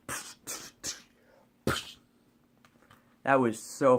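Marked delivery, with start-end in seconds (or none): 0.1-2.0 breathy unvoiced psh sounds, soft; imitated Hulk smashing. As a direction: puff out the smash noises under your breath. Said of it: "grabs him and goes".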